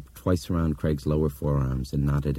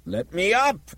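high-pitched demanding shout; urgent yell, pitched way up; 4 dB louder, slower than usual. A narrator's reading. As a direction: cry out to be released. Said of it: "Craig shouted".

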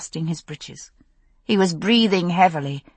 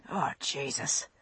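pained groan; 4 semitones lower, 14 dB quieter, slower than usual. Acted. Wounded man touches his head.

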